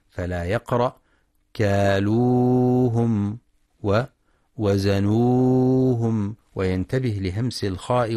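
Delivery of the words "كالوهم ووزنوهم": كالوهم ووزنوهم recited very slowly with the natural two-count madd; tajweed demo. Shown as a demonstration. Teacher demonstrates the two-count madd in slow recitation. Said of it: "وللمد الطبيعي في كالوهم".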